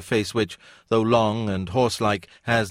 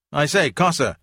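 high, louder call to a passerby; 7 semitones higher, 5 dB louder than usual. A narrator's reading. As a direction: hail Cossar as he walks past. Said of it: "he called out to".

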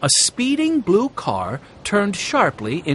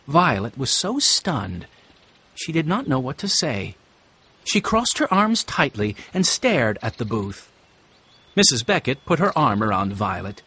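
usual speaking voice

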